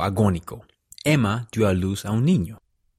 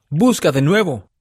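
high, loud, desperate command; urgent order; 7 semitones higher, 6 dB louder than usual. A narrator's reading. José ordering Martin to search, urgent command. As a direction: command it loudly, voice pitched up. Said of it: "le ordenó a Martin".

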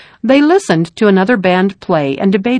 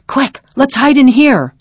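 urgent, higher-pitched storybook call; mild acted yell; 6 semitones higher, 3 dB louder than usual. A narrator's reading. Jonathan urges his fleeing friends to hide, mild yell. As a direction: urge friends to hide, raised but contained. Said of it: "he yelled".

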